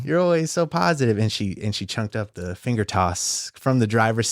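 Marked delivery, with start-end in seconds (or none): 0.0-1.2 sarcastic. Retold or imitated. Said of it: "kinda being sarcastic".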